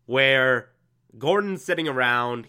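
ordinary delivery

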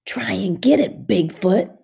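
teasing whisper with a grin; boy taunts Ramona under his breath. A narrator's reading. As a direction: whisper it smugly. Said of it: "he whispered back with a grin".

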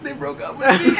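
high-pitched retold blubbering; mock tearful wail. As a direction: imitate yourself bawling the news.

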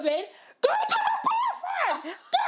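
very high, loud, drawn-out yell; hammed-up panicked order, laugh behind it; imitated shouting. Boyfriend shouting at vomiting girlfriend, imitated frantic shout. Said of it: "He's shouting at me".

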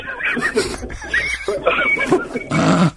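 high squealing laugh; burst of laughter. Real, not acted. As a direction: crack up laughing helplessly. Host cracks up at quack joke.

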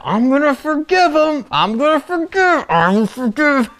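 high, straining through clenched teeth; hammed-up, seething effort to forgive; forced determination. Hurt friend left out, forgiving with strained determination. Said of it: "with determination".

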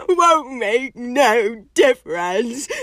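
hammed-up tearful wail, slow and loud; sobbing. A narrator's reading. Hagrid to his visitors about the doomed case, sobbing. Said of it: "sobbed Hagrid".

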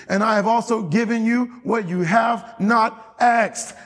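high ringing pulpit reading; preached scripture. Acted. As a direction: proclaim God's words from the pulpit. Reads scripture aloud in preaching.

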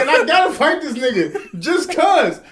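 high, loud comic rant; retold panic. Man retells dreading a fight with stranger, loud comic panic. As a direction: rant in mock dread, half laughing.

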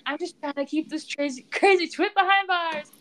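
high-pitched, put-on exasperated complaint; mock exasperation. Man complaining as he's shoved out, hammed-up exasperation. Villain's exasperated line voiced in recap.

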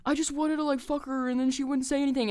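high-pitched mocking imitation of his complaint; put-on high voice of an unhinged complainer; mock ranting man. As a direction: whine it high, imitating him griping to the cops. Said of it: "just talking to these cops".

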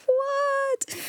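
very high, drawn-out mock shock; mock astonishment. Reader imitating the astonished men, mock shock. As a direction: squeak it out in hammed-up disbelief.

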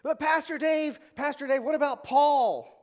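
high, loud questioning voice; a skeptic calling out a challenge; a congregant heckling Pastor Dave about Paul; objecting listener. Pretend.

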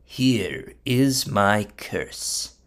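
very slow, low-key proclamation; drawn-out, ominous lead-in; solemn announcement. Acted. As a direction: announce it gravely, word by word. Bilam solemnly begins his curse.

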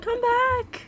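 high-pitched playful call; mock calling out. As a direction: call after someone running away, in play. Calling after imagined fleeing fan.

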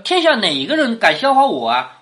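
normal talking voice

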